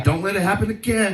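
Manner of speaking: a hint of a stern scolding; mock stern rebuke. Comic to girl whose foot he ran over, mock stern. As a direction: scold her lightly, tongue in cheek. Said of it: "I was like".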